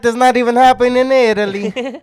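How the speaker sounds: mock Italian accent. Continuing the Italian accent bit.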